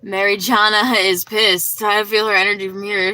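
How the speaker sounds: mock-alarmed loud teasing; playful loud call-out. Real, not acted. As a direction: call her out loudly, half-joking. Teasing a viewer who keeps posting.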